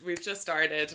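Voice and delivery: ordinary talk, nothing marked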